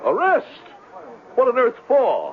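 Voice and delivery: high indignant disbelief; outraged gasp of a question; shocked. Acted. Con man shocked by arrest.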